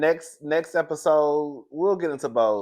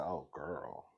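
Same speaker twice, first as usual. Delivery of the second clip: muttered aside; 9 semitones lower, 17 dB quieter than usual. Retold or imitated. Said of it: "I said".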